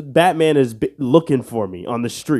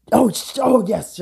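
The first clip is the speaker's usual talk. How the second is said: excited yelp; 10 semitones higher than usual. Real, not acted. Got the answer right.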